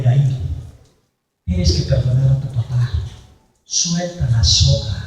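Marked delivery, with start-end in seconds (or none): none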